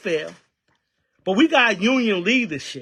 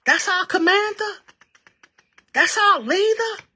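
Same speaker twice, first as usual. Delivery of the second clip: high, incredulous mocking questions at the union vice president; sarcastic disbelief; 10 semitones higher than usual. Real, not acted. Union member ranting about the vice president, sarcastic and much higher. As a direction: scoff at the idea he leads anyone.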